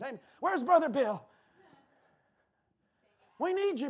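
usual speaking voice

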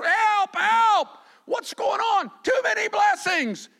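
high, loud frantic cry of fishermen overwhelmed; panicked shout over a boat full of fish; shouting for help. Retold or imitated. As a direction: yell it like the nets are breaking. Peter's crew calls out for help.

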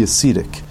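"ascetic" said uh-SEE-tic; long E ascetic. Real, not acted. Host reading the line aloud, "ascetic" as uh-SEE-tic. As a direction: give "ascetic" a long EE in the middle. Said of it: "Not ascetic".

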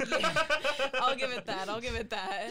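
cracking up while agreeing; real laughter spilling into the words; laughing. Real, not acted. Cracks up at the joke.

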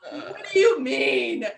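slow, high disbelieving question; incredulous. Real, not acted. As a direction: stretch it out in total disbelief. Outraged at Anna's comment.

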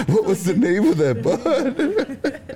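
high slow laughing retold question. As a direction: squeak it out while cracking up. Laughing retelling mom's question.

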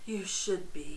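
low, soft character voice; unsympathetic man. Acted. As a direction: play him low and quiet, without sympathy. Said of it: "he has no sympathy for her".

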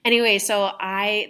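normal talking voice

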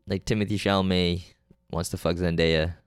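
ordinary delivery

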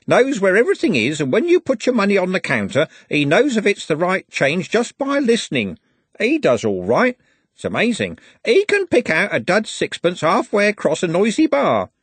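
put-on old man telling a pub tale, admiring; lightly voiced elderly gent, amazed; elderly gentleman. A narrator's reading. Elderly gentleman tells of bloke.

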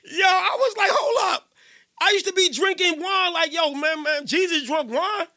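high, loud laughing shout of shock; excited shouting disbelief. Retold or imitated. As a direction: yell it out like you just caught something wild.

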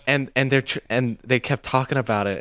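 usual speaking voice

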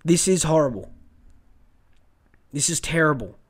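low, heartbroken and subdued; really upset. Real, not acted. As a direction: let the hurt pull the voice down. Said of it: "I'm getting really upset over this".

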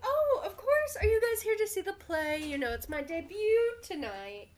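high, bright starlet gush; bubbly Skyla voice. Acted. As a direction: chirp it out like a diva. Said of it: "And Skyla's like".

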